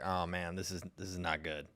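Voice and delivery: low, quiet, let-down mutter; disappointed groan. Retold or imitated. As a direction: sink your voice in disgust. Mock reaction to bad food.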